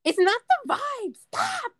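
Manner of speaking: high, slow mock protest, shooting up on Stop; mimicked whiny viral-video voice; imitating TikTok woman. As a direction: draw it out high, then squeal the last word. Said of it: "this chick, she's like".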